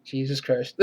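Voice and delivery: normal talking voice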